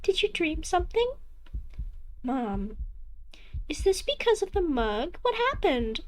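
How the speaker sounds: a doting mother, casually pleased; cheery mother voice. Acted.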